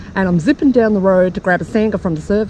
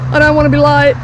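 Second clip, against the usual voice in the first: louder, fast hurried fretting; 7 semitones higher, 7 dB louder, faster than usual. Acted. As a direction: fuss about being late. Rushing to driver.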